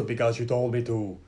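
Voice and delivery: a hint of a low robot answer; Terminator. Eight hundred answering John, lightly imitated low reply. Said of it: "John looks at the eight hundred".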